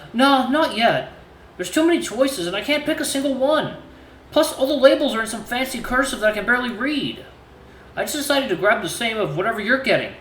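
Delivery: shy and overwhelmed; stammering awkward suitor. Acted. Voices Cecil.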